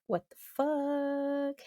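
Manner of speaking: hushed, drawn-out what the fuck; stunned disbelief. Retold or imitated. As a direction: let it slip out slowly as the brakes give. Brakes fail, disbelief.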